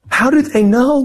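high-pitched, incredulous question; mock bafflement. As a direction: act out being utterly mystified. Retells their bafflement at others knowing.

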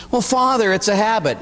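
a hint of a shrugging sinner; excusing parishioner. Parishioner excusing swearing to the priest, lightly whiny.

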